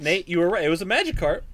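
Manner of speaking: loud concession. Real, not acted. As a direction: call it out loud. Loudly conceding.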